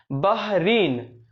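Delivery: very slow drawn word. Shown as a demonstration. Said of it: "drilling the word".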